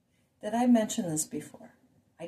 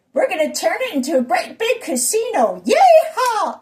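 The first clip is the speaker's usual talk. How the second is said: high, loud, hammed-up glee; gleeful mock whooping; 9 semitones higher, 8 dB louder than usual. New owners planning a casino, gleeful mock whooping. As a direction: crow it, bursting into a cowboy yeehaw.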